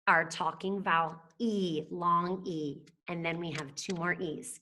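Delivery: usual speaking voice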